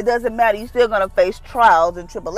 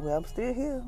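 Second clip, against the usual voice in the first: Jesus; 4 semitones higher, 10 dB quieter than usual. Acted. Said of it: "And Jesus like".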